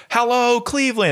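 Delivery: a light Peter Griffin call, pitched high; hint of a cartoon dad greeting a crowd. Retelling Family Guy concert, lightly voiced Peter Griffin. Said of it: "Peter Griffin goes".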